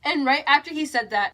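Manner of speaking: ordinary talk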